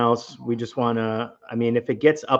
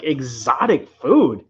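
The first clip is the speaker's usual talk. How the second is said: slow, stretched-out mock disbelief; retold astonishment, swooping pitch; incredulous echo; 4 dB louder, much slower than usual. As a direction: repeat it back as a drawn-out, baffled question. Incredulous echo of roommate's words.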